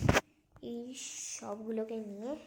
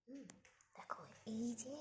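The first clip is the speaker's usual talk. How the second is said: whispering; 3 semitones higher than usual. Real, not acted. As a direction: keep it to a whisper while packing. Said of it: "আস্তে আস্তে বলছি".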